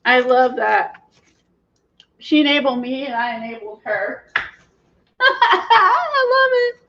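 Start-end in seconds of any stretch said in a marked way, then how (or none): 4.3-6.7 slow words through high laughter; laughing. Real, not acted. Delighted at enabling her friend, laughing while saying it.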